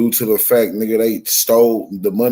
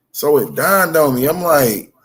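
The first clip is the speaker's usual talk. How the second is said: ordinary talk, nothing marked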